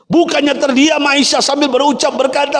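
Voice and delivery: high, fervent preaching cry; rising sermon fervour; impassioned preaching. Acted. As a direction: preach it at full force, climbing high. Preacher narrating at full force.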